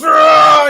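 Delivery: loud roaring yell; monster roar. Acted. Monster roaring back at the fleeing crowd. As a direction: roar as you charge. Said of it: "And he goes".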